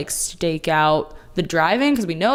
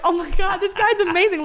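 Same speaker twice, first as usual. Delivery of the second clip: excited; 11 semitones higher than usual. Retold or imitated. Excited fan reaction to Smithers.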